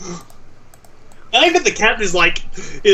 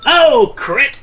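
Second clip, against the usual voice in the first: high, loud burst of surprise; excited exclamation; 7 semitones higher, 5 dB louder than usual. Real, not acted. Player reacting to a crit, excited exclamation.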